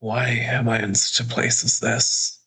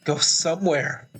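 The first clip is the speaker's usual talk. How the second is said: afraid; 6 semitones higher than usual. Acted. Narrator begs monster to leave.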